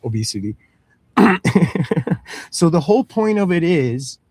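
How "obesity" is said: low, soft no-nonsense dad; low, soft, dismissive parent reply; gruff parent. Retold or imitated. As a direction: talk the kid down from a lower voice. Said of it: "It's like".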